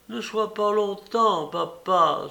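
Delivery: high, pleading call after him. A narrator's reading. La fille supplie son père qui sort. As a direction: beg father to hurry back. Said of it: "supplia Athalie".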